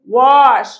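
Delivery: wash said loud, the sh drawn out clearly; sh sound stressed. Shown as a demonstration. Teacher drilling the class on the sh in wash. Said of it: "محدش ينسى إن اس اتش نطقها ش".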